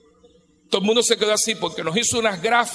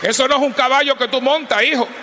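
loud, higher scolding to the men; raised, higher scolding shout; 5 semitones higher, 6 dB louder than usual. Real, not acted. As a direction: tell them off sharply.

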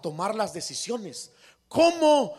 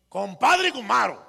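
louder, higher bossy opening; loud demanding letter; 4 semitones higher, 7 dB louder than usual. Retold or imitated. Rich man mocking his poor compadre's rude letter, loud demanding. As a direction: bark out the greeting like a demand. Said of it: "así hablaba ya el señor".

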